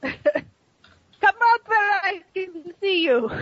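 put-on slow, high Russian-accented command; drawn-out, high-pitched Russian voice. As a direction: call them out slowly with a Russian accent. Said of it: "a Russian-sounding voice yell out to you".

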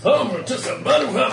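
high, playful mock of another player; hammed-up mimicry. Game master teasing a player about the new hammer, hammed-up mimicry. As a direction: mimic them in an exaggerated high voice.

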